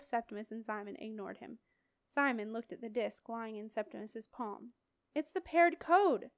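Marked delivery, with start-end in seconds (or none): 5.1-6.3 higher, louder, slower thrill at the find; excited. Acted. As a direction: savour the discovery with delight. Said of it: "he said excitedly".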